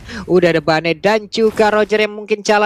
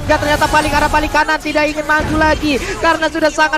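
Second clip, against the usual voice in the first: excited play-by-play; 6 semitones higher than usual. Real, not acted. Caster calls teamfight.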